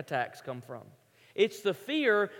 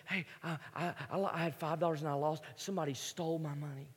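put-on quiet, hurried panting boy; softly puffing little camper; mock out of breath; 3 semitones lower, 8 dB quieter, faster than usual. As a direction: play a breathless kid rushing the words. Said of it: "they're out of breath".

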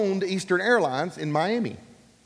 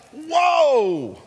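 high, loud, drawn-out mock astonishment; hammed-up awestruck cry; amazed exclamation; 10 semitones higher, 6 dB louder than usual. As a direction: let the wonder ring out long. His amazement at the free ticket.

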